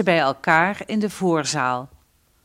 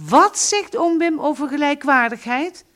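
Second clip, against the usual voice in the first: higher, louder indignant question; fuming; 9 semitones higher, 5 dB louder than usual. Acted. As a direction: snort it out in outrage. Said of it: "briest pa".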